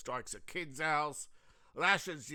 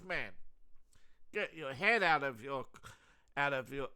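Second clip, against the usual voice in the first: ordinary delivery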